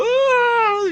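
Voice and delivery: long, high, loud scream; imitated terrified scream. Showing the man's switch from calling out, imitated terror scream. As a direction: scream as if in agony, mimicking him. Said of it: "screaming in agonizing terror".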